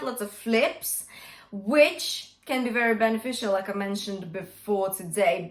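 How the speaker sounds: ordinary talk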